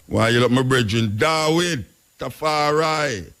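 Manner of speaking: normal talking voice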